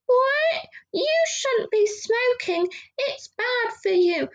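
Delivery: high-pitched horrified squeal; shocked fans. Retold or imitated. Fans scolding [name] about smoking, in a mock shocked squeal. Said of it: "people are going like".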